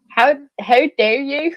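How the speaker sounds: hesitant attempt at an Irish accent; amateur put-on Irish accent, on the edge of giggles. Shown as a demonstration. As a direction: have a tentative go at Irish. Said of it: "I'll give it a go".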